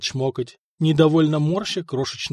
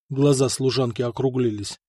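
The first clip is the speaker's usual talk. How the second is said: usual speaking voice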